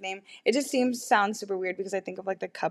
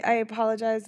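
usual speaking voice

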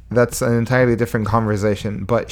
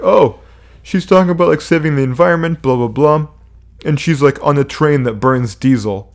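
mock critic; 3 semitones higher than usual. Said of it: "they're like".

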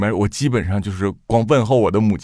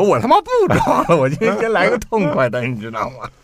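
cathartic outburst; 8 semitones higher than usual. Retold or imitated. Imagined father dropping restraint.

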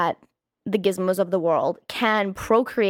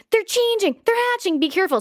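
alarmed warning; 10 semitones higher, faster than usual. Retold or imitated. Son warning on phone, retold.

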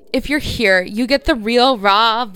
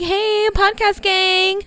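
playful fan greeting; 10 semitones higher, slower than usual. Pretend. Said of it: "Just be like".

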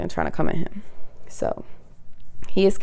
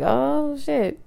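high, drawn-out realization; stunned reaction; 9 semitones higher, 4 dB quieter than usual. Retold or imitated. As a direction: re-enact it high, letting it fade. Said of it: "when I was like".

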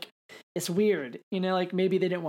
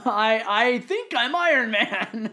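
slow mock line; 5 semitones higher, 6 dB louder, much slower than usual. Mock misquote, drawn out.

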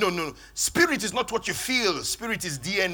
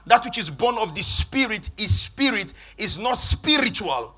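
louder repeat, punching "not" in "is not spiritual"; emphatic repeat; 4 semitones higher, 7 dB louder than usual. Shown as a demonstration. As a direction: hammer the line home, hitting "not". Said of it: "Did you see that?".

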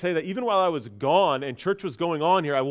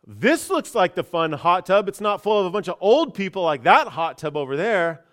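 loud, higher-pitched imitation of a guy yelling; retold yell; 4 semitones higher, 6 dB louder than usual. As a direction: call it out loudly like a rowdy guy. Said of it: "one of the guys yells out".

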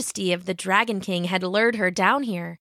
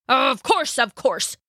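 agreeing dragon king; 5 semitones higher, slower than usual. A narrator's reading. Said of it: "agreed the king".

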